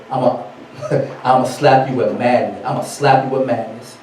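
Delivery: low, soft voice of God; voice of Yah. Acted. Yah cursing his people, softly menacing. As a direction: lightly play God pronouncing a curse, low and quiet. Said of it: "And Yah said".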